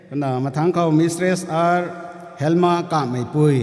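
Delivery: formal roll-call announcement; calling out names. Real, not acted. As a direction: call the next name out clearly.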